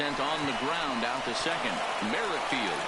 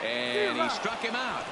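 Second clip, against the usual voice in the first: excited call; 8 semitones higher than usual. Real, not acted.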